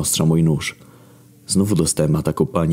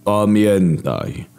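one drawn-out divine order; God orders the archangel to remember; slow commanding God; 4 semitones higher, much slower than usual. Acted. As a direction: stretch it as a command.

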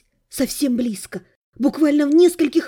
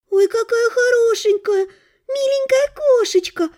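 put-on high cooing baby talk, much higher; 10 semitones higher than usual. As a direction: coo over the kitten in a high sing-song. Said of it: "засюсюкала Ксюшенька".

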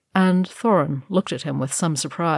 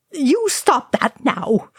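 high, slow scolding; harsh rebuke; 8 semitones higher, 3 dB louder, slower than usual. A narrator's reading. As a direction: scold him sharply. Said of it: "Oin said harshly".

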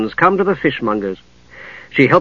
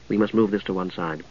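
low, cultured woman's voice; 5 semitones lower, 10 dB quieter than usual. A narrator's reading. Catherine instructs.

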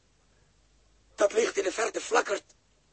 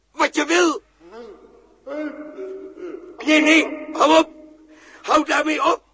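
higher, louder panicked pleading; frightened shout; 7 semitones higher, 7 dB louder than usual. Acted. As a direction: beg it to stop, voice straining. Joost smeekt de stem te stoppen. (Joost schreeuwt angstig tegen de stem dat hij moet ophouden)

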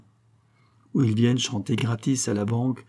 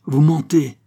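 louder, higher outburst; indignant cry; 6 semitones higher, 7 dB louder than usual. A narrator's reading. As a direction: cry it out as an accusation. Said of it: "s'écria-t-elle". (s'écrier, indignée)

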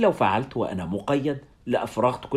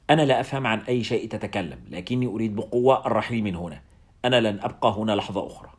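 ordinary delivery

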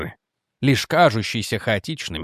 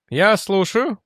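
firm reply, pitched higher; higher, resolute two-word reply; 6 semitones higher, slower than usual. A narrator's reading. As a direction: answer firmly, ready to be told. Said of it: "твёрдо сказал Элснер".

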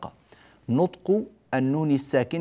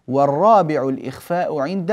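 poem recitation; 2 semitones higher, 5 dB louder than usual. Shown as a demonstration. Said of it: "قال الناظم رحمه الله".

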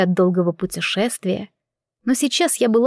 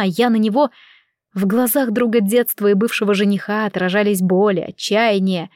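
ordinary delivery, nothing marked

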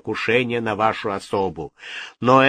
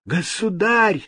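much higher cry of Государь; shouted plea; 10 semitones higher, 3 dB louder, slower than usual. A narrator's reading. Astrologer cries to the king for mercy. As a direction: throw yourself at the king's feet crying. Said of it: "закричал Руджиери".

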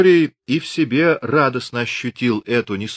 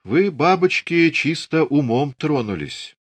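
slow, hurt reproach, voiced higher; a touch of offended old woman; 7 semitones higher than usual. A narrator's reading. Ilyinichna reproaches the young women, slow and hurt. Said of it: "обиженно поддержала".

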